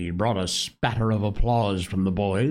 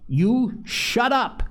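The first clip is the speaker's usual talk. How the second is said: fierce, slow snap pitched high; 12 semitones higher, slower than usual. A narrator's reading. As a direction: bite off each word at Piggy. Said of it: "Jack turned fiercely".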